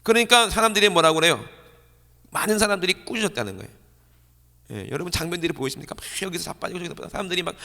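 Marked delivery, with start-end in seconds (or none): none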